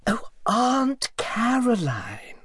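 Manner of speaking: high, slow, whispered correction of the name; irritably. Acted. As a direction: hiss the correction, high and drawn out. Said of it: "said the lady irritably".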